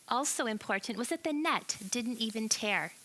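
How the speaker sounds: ordinary talk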